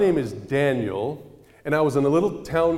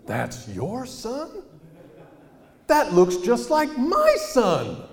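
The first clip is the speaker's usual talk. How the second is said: put-on high, slow, then loud amazed old woman; amazed old lady; 10 semitones higher, 5 dB louder, much slower than usual. Old lady telling the mother he looks like her son, high and amazed. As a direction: stretch it out, astonished old lady.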